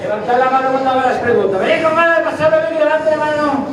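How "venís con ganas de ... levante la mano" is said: high-pitched rallying call to the audience; crowd hyping. Real, not acted. Comic calls for a show of hands, hyped up. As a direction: pitch it high to rouse a room.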